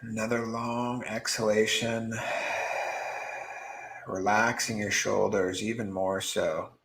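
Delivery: soft, unhurried meditation voice with long pauses; guided meditation. Acted. Meditation teacher guiding beginners to drop worry, soft and calm. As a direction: ease them gently into stillness, softly.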